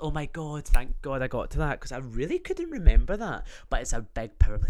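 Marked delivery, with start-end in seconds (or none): none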